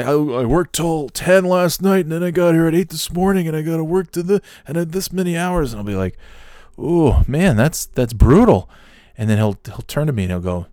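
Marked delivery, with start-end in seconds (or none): 0.0-5.7 high-pitched imitation of a complainer; put-on self-pitying voice, much higher; woe-is-me whine. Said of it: "Oh, woe is me". 6.8-8.7 higher, louder commiseration; drawn-out, emphatic fellow-feeling; mock sympathy. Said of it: "show some empathy".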